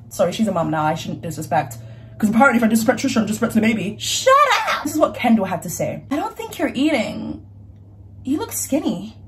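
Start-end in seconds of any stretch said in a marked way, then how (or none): 4.0-4.8 high squeal of shut up; a playful shocked shriek; mock disbelief. Mock disbelief at her own joke. 6.1-9.2 put-on a light Valley girl accent, slow and soft; a hint of Valley girl, quiet and slow. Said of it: "I love me a good Valley accent".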